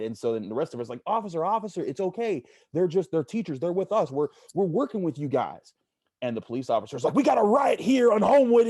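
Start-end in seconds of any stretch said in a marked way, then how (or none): 1.0-5.7 higher, a bit louder pleading with officer; calming a cop, voice raised; retold pleading. Group pleading with tackling officer. 7.1-8.7 much higher, louder alarmed call-in; mock cop radio shout. Cop calls in a riot.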